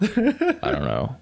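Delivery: slow, high, metallic robot voice; droning robot voice, stretched out slowly. Shown as a demonstration. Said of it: "I could do the robot voice".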